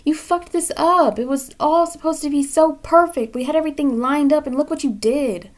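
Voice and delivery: exasperated spirit guides. Retold or imitated. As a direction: vent as the fed-up guides. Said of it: "your guides ... they're like".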